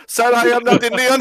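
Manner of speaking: high-pitched mock Indian accent; Indian scammer denying it. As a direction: protest in a put-on Indian accent. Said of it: "Check if he's Indian".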